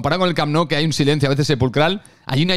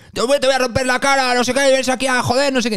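high, menacing Argentine threat, much higher; threatening Argentine fan; 9 semitones higher than usual. Retold or imitated. Fan threatening the friend, menacing Argentine accent. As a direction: threaten to smash his face in, Argentine style. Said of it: "Se nos giran y nos dicen".

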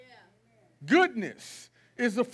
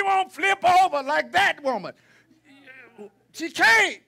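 preaching shout; 3 semitones higher, 3 dB louder than usual. Real, not acted. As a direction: rebuke them at full preaching force. Preacher scolding the congregation.

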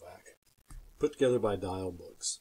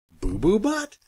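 incredulous squeal; 10 semitones higher, 6 dB louder, slower than usual. Acted. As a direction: BUTT rising, much higher and louder. Reacting to the silly name.

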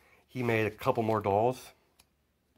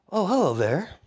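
higher, louder sly hello; creepy greeting; 9 semitones higher, 5 dB louder than usual. Acted. Kid villain taunting heroes through a TV, sly. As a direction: greet them like a smug villain on screen.

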